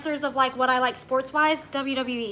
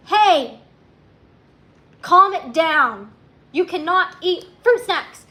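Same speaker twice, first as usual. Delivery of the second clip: scolding; 4 semitones higher, 7 dB louder, slower than usual. Real, not acted. Scolding a child off-camera.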